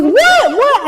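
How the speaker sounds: very high, repeated squeak of disbelief; shocked. Retold or imitated. Friend on the phone at hockey, shocked. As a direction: yelp it in astonishment.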